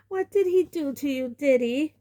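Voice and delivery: high, anxious mother; mild quaver of fear; mother asking son fearfully. A narrator's reading. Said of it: "in a quavering voice".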